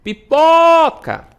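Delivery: pipoca called loud and high, po stretched long; calling out the word. Shown as a demonstration. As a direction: call pipoca like a name, holding the po. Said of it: "O pó demora mais". (pipoca chamada alto, com o pó alongado)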